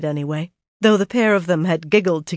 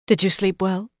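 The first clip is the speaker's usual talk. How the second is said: untroubled; 2 semitones higher than usual. A narrator's reading. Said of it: "Her tone was untroubled".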